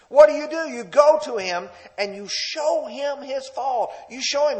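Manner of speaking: emphatic preaching. Real, not acted. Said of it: "preaching emphatically".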